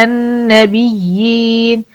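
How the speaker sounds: slow drawn "النبيين" with long final ي; Quranic recitation. Shown as a demonstration. المعلمة تقرأ كلمة النبيين ببطء. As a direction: recite "النبيين" slowly, holding the madd. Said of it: "يعني زي كلمة إيه؟".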